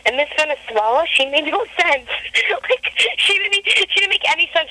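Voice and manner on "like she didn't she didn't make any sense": laughing through the words, high; giggling disbelief. Real, not acted. Mocking contestant's answer.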